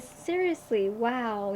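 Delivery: ordinary delivery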